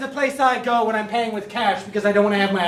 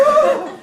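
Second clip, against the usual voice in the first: sharp shocked gasp; 4 dB louder than usual. Acted. Shocked reaction in scene.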